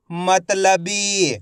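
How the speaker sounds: मतलबी very slow, ending on long bee; blended word demo. Shown as a demonstration. Teacher reading for pupils to repeat, मतलबी stretched. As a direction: draw out मतलबी by syllable. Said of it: "आपको मेरे साथ साथ repeat करना है".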